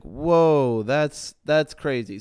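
retelling a stunned reaction while reading; amazed reaction. Retold or imitated. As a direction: react like your mind is blown. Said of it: "I was like".

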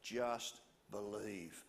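calm him down gently; gentle reassurance. Acted. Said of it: "Jesus looked at Jairus and he says".

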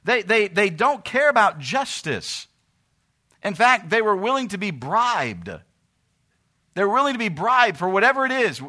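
loud, high, impassioned pulpit delivery; fiery sermon voice; preaching at full force. Acted. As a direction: thunder it from the pulpit.

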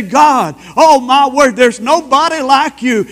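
loud, high burst of worship; shouted praise. Acted. Preacher showing how to praise God, loud high shout. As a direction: cry it out to God.

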